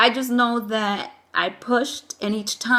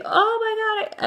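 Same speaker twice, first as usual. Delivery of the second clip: mild retold cry of overwhelm, pitched way up; overwhelmed exclamation; 12 semitones higher than usual. Retells being hit by intense contractions.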